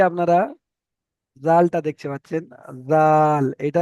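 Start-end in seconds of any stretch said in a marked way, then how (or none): 2.9-3.4 "জাল" with a light, thin Z and long A; thin pronunciation. Shown as a demonstration. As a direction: say "জাল" thinly, drawing out the vowel. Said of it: "পাতলা করে". (পাতলা করে "জাল")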